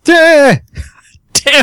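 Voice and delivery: high exasperated exclamation; frustrated outburst. Real, not acted. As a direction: blurt it out in dismay. Door reopens, player frustrated.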